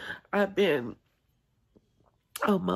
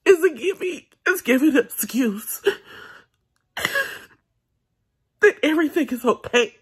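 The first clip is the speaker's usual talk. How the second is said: louder and much higher, pushing through hurt; raised emotional voice; 5 semitones higher, 7 dB louder than usual. Real, not acted. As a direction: let it burst out that silence isn't okay. Venting about hurt, voice rising.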